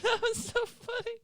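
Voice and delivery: real high squealing laughter; laughing hard. Real, not acted. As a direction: squeal through tears of laughter.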